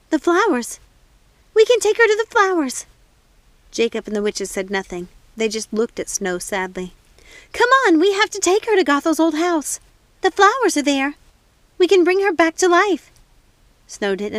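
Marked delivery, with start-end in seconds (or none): 0.1-2.8 high, loud, bursting with hope; elated cry. A narrator's reading. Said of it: "she was almost elated". 7.5-13.1 high, loud, insistent urging; urgent plea. Acted. Snow urging the witches.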